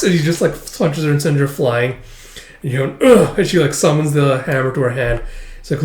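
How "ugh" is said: high, loud grunt; mock grunt of impact; acted fight grunt. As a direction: imitate a blow landing. Retold effort in fight.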